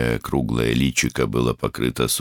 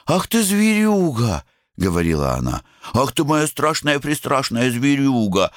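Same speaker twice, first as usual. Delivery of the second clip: высокое нежное сюсюканье; сюсюкай с псом, медленно и высоко; сюсюканье с собакой; 12 semitones higher than usual. A narrator's reading. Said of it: "невразумительную ласковую трепотню". (нежное сюсюканье с собакой)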